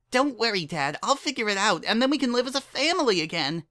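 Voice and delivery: put-on high, hurried, hopeful girl voice; eager young Dinky. A narrator's reading. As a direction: rush it out, full of hope.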